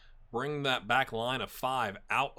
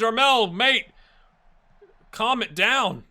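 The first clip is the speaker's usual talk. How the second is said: raised, higher call to a player; exasperated louder plea to a teammate; 9 semitones higher, 10 dB louder, slower than usual. Real, not acted. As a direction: shout him down to calm down. Yelling at his own player.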